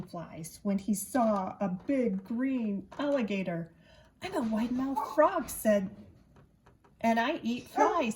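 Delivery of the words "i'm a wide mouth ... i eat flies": loud, higher, boastful frog; proud frog. A narrator's reading. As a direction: puff up and announce it like the frog. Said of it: "said the wide mouth frog".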